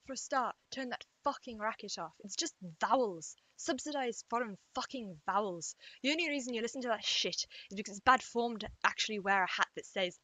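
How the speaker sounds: attempted Scottish rant, held back from shouting; Scottish accent. English vlogger doing Malcolm Tucker quote without waking parents, Scottish accent. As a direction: rattle off an angry telling-off in Scottish. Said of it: "do this in a Scottish accent".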